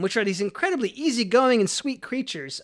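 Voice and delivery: ordinary talk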